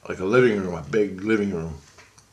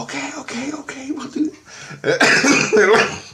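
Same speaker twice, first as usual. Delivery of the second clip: put-on very high-pitched children's chorus; imitated eager kids; 4 dB louder, slower than usual. Kids agree to father's plan, high imitated voices. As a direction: squeak it out like little kids agreeing.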